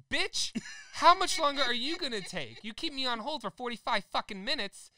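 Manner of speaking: high, loud, outraged yell; yelling at the rep about the hold time; furious retold rant. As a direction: let him have it, shrill with outrage.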